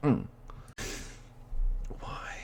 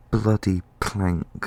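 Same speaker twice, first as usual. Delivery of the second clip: slow, loud exasperated blurt at herself; a slow, loud burst of annoyance at her own clumsiness; self-scolding outburst; 11 dB louder, much slower than usual. Real, not acted. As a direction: drag the words out loud, fed up with yourself. Scolding herself after a blunder.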